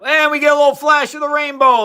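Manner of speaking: high, loud burst of delight; excited reveal. Real, not acted. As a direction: announce it like a magic trick coming off. Announcing the colour change in demo.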